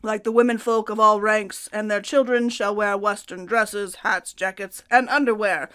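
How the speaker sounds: put-on forceful decree in a Russian accent. Co-host reads the emperor's dress rules for women, Russian accent. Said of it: "That's my Russian accent".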